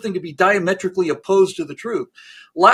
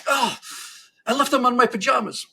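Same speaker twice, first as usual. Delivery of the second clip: higher, comically dismayed discovery; Brezhnev's dismayed realization; 7 semitones higher than usual. Acted. Brezhnev looking down for his medals, comic dismay. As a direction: realize a silly mistake aloud.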